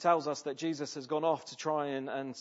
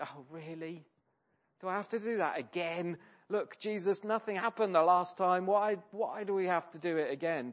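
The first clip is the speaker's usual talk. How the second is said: higher, louder weary protest; reluctant complaint; 5 semitones higher, 5 dB louder than usual. Retold or imitated. Fisherman complaining to Jesus, reluctant and weary. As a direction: grumble that it's pointless, pitched up. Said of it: "it must have been like".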